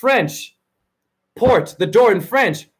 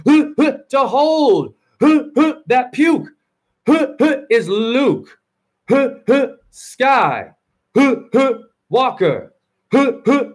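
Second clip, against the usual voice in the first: words squeezed between mock heaving gasps, higher; straining, panting speech; 4 semitones higher than usual. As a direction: talk while struggling for breath under a load. Straining while holding friend's puke.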